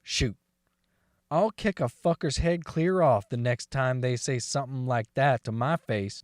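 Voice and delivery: usual speaking voice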